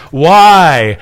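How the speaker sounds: mildly acted demand for a reason; protesting appeal. Pilate bargaining with the mob, protesting appeal. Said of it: "he's again bargaining with the mob".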